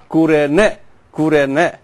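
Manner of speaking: Kurene said koo-reh-NEH; original-style pronunciation. Shown as a demonstration. Teacher explaining the old name of Cyrene, demonstrated pronunciation. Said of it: "but it pronounces U".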